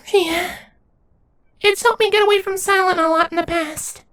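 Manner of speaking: put-on very high, small childlike creature voice; small changeling nymph. A narrator's reading. Nymph answering Lenz in a high little voice.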